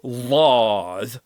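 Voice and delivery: "lád" said with an open ah vowel; Gaelic word lád. Shown as a demonstration. Teacher modelling "lád" for learners, carefully. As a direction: sound out "lád" slowly and clearly. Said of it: "Every part of this word".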